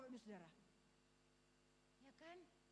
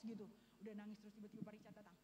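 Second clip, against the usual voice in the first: normal talking voice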